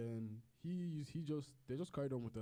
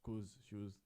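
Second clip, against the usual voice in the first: normal talking voice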